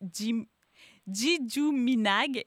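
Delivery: "Jijuminag" attempted slowly and carefully after a false start; unsure pronunciation. Real, not acted. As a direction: stumble on "Jim-", then sound out "Jijuminag" slowly. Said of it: "excusez-moi pour la mauvaise pro-, prononciation". (« Jijuminag » prononcé lentement, avec hésitation)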